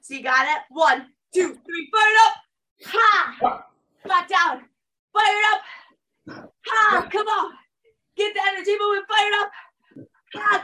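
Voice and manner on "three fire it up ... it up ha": high, punchy exercise cues; fired-up coaching call. Real, not acted. Coach calling out squats to the class, fired up. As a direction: drive the squats with high-pitched energy.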